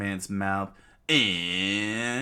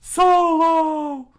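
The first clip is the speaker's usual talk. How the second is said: very high, loud scream of a name; 13 dB louder than usual. Acted. Usopp screams to his friend in danger, very high loud yell. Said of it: "Usopp screamed out".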